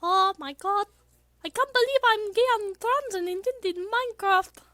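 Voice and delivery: put-on very high, far brighter anime girl voice. Streamer granting chat's request in Miku voice. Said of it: "this isn't my best Miku voice".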